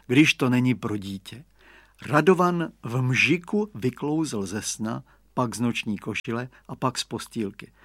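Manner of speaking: plain reading voice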